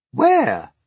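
high, loud, short question; loud high cry; crowd asking. A narrator's reading. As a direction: demand it as a startled crowd. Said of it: "they asked".

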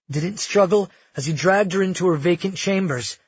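plain reading voice

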